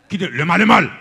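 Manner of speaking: loud, forceful big voice. Shown as a demonstration. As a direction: say it big and punchy, at normal pitch. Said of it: "on l'a utilisé en gros voix". (gwo vwa)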